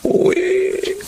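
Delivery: sudden high surprised exclamation. Acted. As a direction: jump up in pitch, caught off guard. Father surprised by wedding date. (gulat na bulalas)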